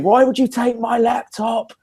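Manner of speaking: high, slow, exaggerated outrage; stretched squeal of mock outrage; mock indignant cry. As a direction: play the wronged victim for the crowd. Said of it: "So I'm saying".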